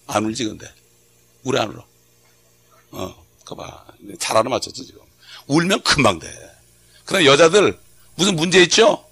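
usual speaking voice

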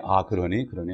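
low, soft sympathetic response; quiet, understanding murmur; embracing, accepting parent. Acted. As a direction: gently take in what the child says. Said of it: "이렇게 품어주는 거예요".

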